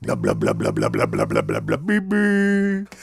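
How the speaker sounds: low muffled blah blah like a faraway grown-up; muffled trombone talk. Pretend. Kids' show host doing the cartoon grown-up, muffled. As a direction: mumble like a cartoon teacher heard through a horn. Muffled adult voice, Peanuts style.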